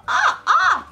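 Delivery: very high, loud fangirl squeal; excited squeal. Real, not acted. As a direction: squeal twice with delight. Thrilled by the signature.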